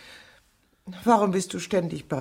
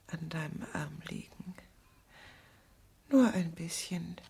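very soft breathy whisper; soft whisper on his arm; intimate whisper; 10 dB quieter than usual. Acted. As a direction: melt into a whisper. Leaning on him, whispering.

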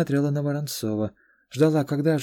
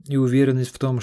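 ordinary delivery, nothing marked

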